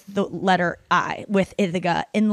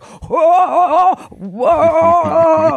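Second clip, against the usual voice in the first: high, loud mock cry of whoa; mock shouted whoa; 8 semitones higher, 7 dB louder than usual. Joking about the code word, a hammed-up loud whoa. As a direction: bellow it out like someone overwhelmed. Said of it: "'cause it's like".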